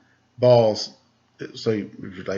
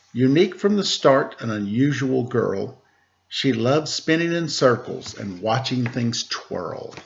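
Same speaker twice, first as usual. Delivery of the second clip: put-on bright, higher read-aloud voice for children; storybook narration; 4 semitones higher, slower than usual. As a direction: read it like a picture book to kids. Reading a picture book aloud.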